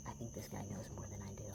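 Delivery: fast, unvoiced whisper; whispered aside. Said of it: "and he's like".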